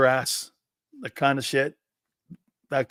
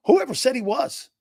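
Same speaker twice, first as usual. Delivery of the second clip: incredulous scoff; 9 semitones higher than usual. Real, not acted. Scoffing at Bryce Huff hype.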